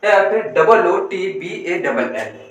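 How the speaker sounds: slow letter-by-letter spelling; spelled out slowly. Shown as a demonstration. Teacher spelling a word slowly for students. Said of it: "एक एक अक्षर".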